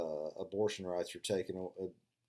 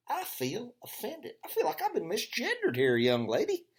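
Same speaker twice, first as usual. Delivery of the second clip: much higher, hammed-up offended voice; mock outrage; 13 semitones higher, 4 dB louder than usual. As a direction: fake being deeply offended. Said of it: "I started to get all kind of spun up".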